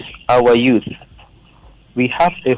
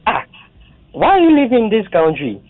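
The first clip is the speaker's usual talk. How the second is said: retold rebuke; 9 semitones higher than usual. Caller retells rebuking youth.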